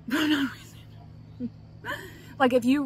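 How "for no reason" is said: slow, laughing through the words; laughing repeat, amused at herself. Real, not acted. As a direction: giggle it out as an aside.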